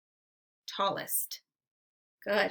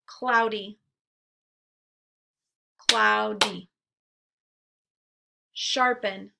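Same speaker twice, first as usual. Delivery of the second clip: ordinary delivery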